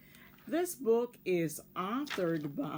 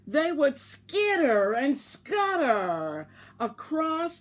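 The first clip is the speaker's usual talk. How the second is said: lively read-aloud voice, bigger than her chat; storybook narration; 5 semitones higher, 7 dB louder than usual. Acted. Teacher reading a picture book to her class, expressive storytelling. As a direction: tell it like a bedtime story to children.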